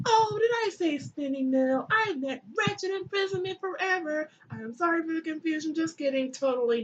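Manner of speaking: high, taunting villain voice; mock apology. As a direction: gloat under a sugary pretend apology. Set mock-apologizing after his trick.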